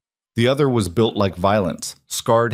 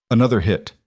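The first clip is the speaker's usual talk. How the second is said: usual speaking voice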